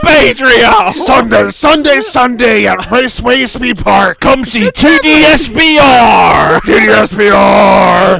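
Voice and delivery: monster truck ad shout. Pretend. Loud rally ad shout.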